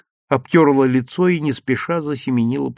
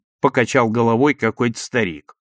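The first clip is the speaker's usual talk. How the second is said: usual speaking voice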